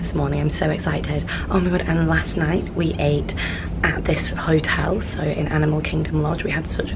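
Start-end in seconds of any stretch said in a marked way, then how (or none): none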